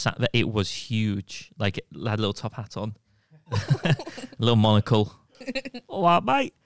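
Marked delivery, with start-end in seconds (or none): 5.9-6.5 high, louder toff voice greeting the spider; a dapper spider tipping its hat, higher and louder; posh spider greeting. Pretend. Telling friends about the spider, as a mock dapper gent.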